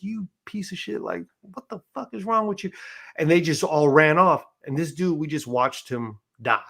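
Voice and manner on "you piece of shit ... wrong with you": high, strained mock yell, kept quiet; retold yelling. Inmates at the fence screaming at staff, retold as a high, quiet mock yell. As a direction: imitate screaming in a high, held-back voice. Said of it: "We start yelling and screaming".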